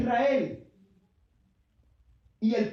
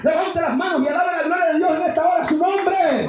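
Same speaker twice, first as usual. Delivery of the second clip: loud, raised preacher's shout; full-force preaching; 4 semitones higher, 6 dB louder than usual. Real, not acted. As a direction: preach it out at the top of your voice. (prédica exaltada a gritos)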